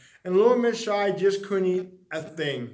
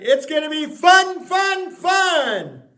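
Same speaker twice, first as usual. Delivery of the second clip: loud, much higher, bouncy fun; cheerful sing-song; 8 semitones higher, 7 dB louder, slower than usual. Acted. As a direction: sell the fun of the party. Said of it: "It added".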